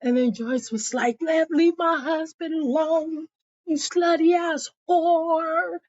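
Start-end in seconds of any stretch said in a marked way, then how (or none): 1.2-5.8 high, indignant wife's voice; retold angry insult. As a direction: hurl it in mock outrage.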